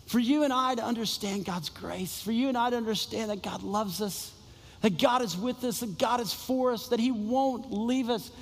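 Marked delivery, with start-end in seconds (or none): none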